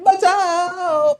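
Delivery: loud, high, long-drawn shout; drowning cry for help. Acted. Teacher acts himself drowning, surfacing to yell, long high shout. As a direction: shout it out, stretching the last vowel. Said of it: "चिल्लाता है".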